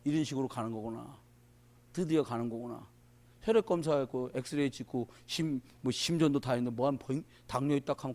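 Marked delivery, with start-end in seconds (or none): 0.0-2.8 resigned inner thought. Retold or imitated. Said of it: "별 생각 다 들어가다가".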